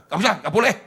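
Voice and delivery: loud mock refusal; forbidding parent. Parent refusing a child's ambition, loud mock forbidding.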